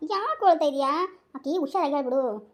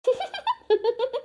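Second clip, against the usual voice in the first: hearty laugh; 9 semitones higher than usual. Acted. Father laughs at being called uncle.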